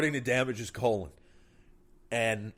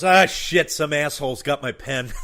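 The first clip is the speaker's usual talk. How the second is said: annoyed outburst; 5 semitones higher, 12 dB louder than usual. Acted. Doctor finds thermometer instead of pen.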